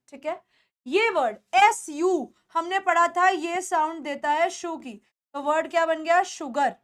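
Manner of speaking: usual speaking voice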